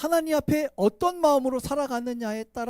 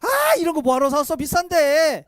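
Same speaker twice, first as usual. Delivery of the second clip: mock-scolding parent; 5 semitones higher, 6 dB louder than usual. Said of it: "하면서 돌아서 웃으시잖아".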